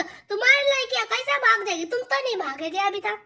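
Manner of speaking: normal talking voice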